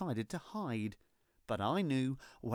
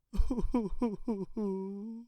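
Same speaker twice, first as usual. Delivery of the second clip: light laugh; 8 semitones higher, 3 dB louder than usual. Real, not acted. Laugh mid-story.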